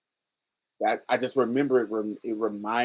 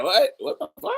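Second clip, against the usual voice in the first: shocked uncle; 9 semitones higher, faster than usual. Retold or imitated. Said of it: "He was like".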